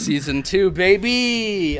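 high, drawn-out cheer; excited hype. Real, not acted. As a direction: whoop it out like a hype man. Hyping the new season.

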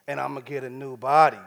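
usual speaking voice

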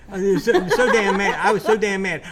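loud, high and laughing as it spills out; wired laughing. Real, not acted. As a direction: get worked up and laugh through the words. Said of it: "I'm getting wired now".

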